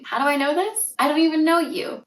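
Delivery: usual speaking voice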